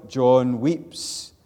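John weeps called out loud and high; loud pulpit cry; ringing proclamation. Acted. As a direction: declare it to the back row. Preacher proclaims John's weeping.

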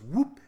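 loud mock "Whoop!" with a rise; loud whoop effect. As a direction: pop out "Whoop!" like a fighting-game sound. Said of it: "And then she goes".

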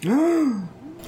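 high, soft gasp of surprise; quick high intake of breath; delighted gasp. Real, not acted. Player reacts with delight to reveal.

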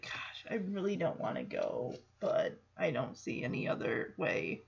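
low, hushed reluctant musing. Retold or imitated. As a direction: think it over quietly, torn. Voices reluctant Jesse weighing options.

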